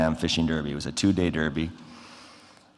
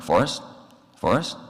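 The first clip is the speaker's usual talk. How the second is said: rising, higher calls of the name; puzzled calling out; 6 semitones higher than usual. Retold or imitated. Into the dead phone line, calling his friend's name, puzzled.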